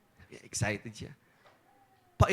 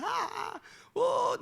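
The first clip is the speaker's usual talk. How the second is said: ordinary talk, nothing marked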